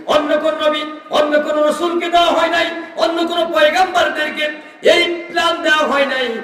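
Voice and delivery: high, insistent proclamation; emphatic preaching. Real, not acted. Preacher listing who never got this gift, high emphatic preaching. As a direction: drive each phrase home at a high pitch.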